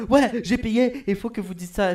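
loud, high-pitched mock complaint; imitated angry customer. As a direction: play a demanding client, hammed up. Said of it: "il est comme". (client fâché imité, criard et aigu)

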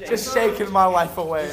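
laughing. Real, not acted. Joking about lemonade job.

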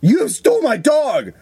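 high-pitched, drawn-out mock outcry; acted accusation. Crickets accusing the woman of stealing her dog, mock distraught cry. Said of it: "She thought the woman had stolen her dog".

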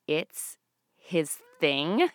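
very slow, incredulous rising repeat; disbelieving echo. Acted. Comic repeating the dad's line in stunned disbelief. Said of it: "Oh my God!".

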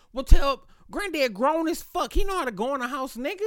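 loud, high, slowed-down outraged yell; heated yell. Retold or imitated. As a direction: blast it back at him like he's crazy. Angry comeback at brother about Granddad.

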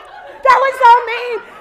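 slow, high, loud wounded cry; stung by the stranger's rudeness, slow loud yell; hurt yell. Retold or imitated. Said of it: "we're just screaming".

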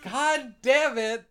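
high, loud, drawn-out curse; exasperated shout. Acted. Game master after a cutscene joke, loud drawn-out exasperation. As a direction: stretch the curse out in mock outrage.

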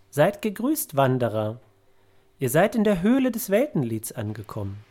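feierlicher Gruß mit gehobener Stimme; begrüße Reisende förmlich, in höherer Tonlage; rufender Geist. Acted. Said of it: "Sie winkt dir zu und ruft". (zurufender Geist, der Wanderer feierlich begrüßt)

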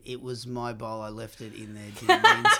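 understated impression of a bandmate; Michael impression. Host asking who does the impression, lightly mimicking. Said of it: "who does the, uh, Michael impression".

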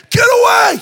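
very high, full-volume yell; police shout. Retold or imitated. Police cutting off Todd's pleading, shouting. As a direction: imitate an officer hollering to clear off. Police yelling again over Todd.

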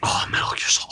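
awed whisper. Real, not acted. Reverent reply to suggestion.

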